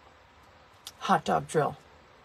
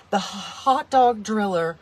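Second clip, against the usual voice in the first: ordinary talk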